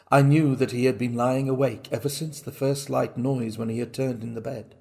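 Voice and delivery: usual speaking voice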